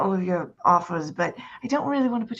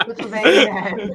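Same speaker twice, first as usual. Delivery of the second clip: mocking taunt; 2 semitones higher, 8 dB louder than usual. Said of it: "She's like".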